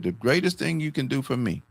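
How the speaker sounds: a low, soft, fading voice; dying teacher's advice. Retold or imitated. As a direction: pass on her last wish gently.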